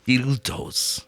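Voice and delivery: put-on a soft German-accented "Dildos."; Herzog impression. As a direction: drop "Dildos." in quietly as Herzog. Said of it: "where he says dildos".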